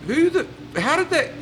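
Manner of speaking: genuinely baffled, voice high; baffled wonder. Retold or imitated. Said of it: "you go".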